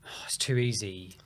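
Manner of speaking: usual speaking voice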